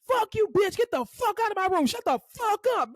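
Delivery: high, furious patient imitation; mock cussing out. Patient cussing out therapist to leave, high mock rage. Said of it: "he cussed me out like that".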